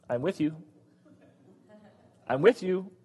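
soft, steady words of comfort; quiet reassurance. Acted. God to Joshua before he leads the people, softly reassuring. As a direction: drop right down to reassure him.

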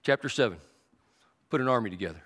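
low, soft, unhurried divine voice; calm God voice. Acted. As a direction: speak as a patient, steady God. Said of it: "God says".

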